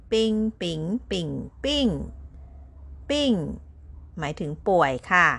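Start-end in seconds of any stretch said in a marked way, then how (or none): 0.1-3.3 bing repeated, landing on fourth tone; tone drill on bing. Shown as a demonstration. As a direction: run bing through the tones to the fourth. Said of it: "ใส่วรรณยุกต์เสียงที่ สี่".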